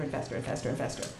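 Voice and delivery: rapid, low-pitched rattle. Real, not acted. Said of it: "start talking faster".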